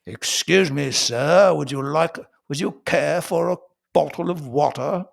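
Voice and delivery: fancy-schmancy British, every T respected; posh British accent. Acted. Posh Brit offering a gentleman water, upper-class British accent. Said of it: "posh British people, they have respect for the tea".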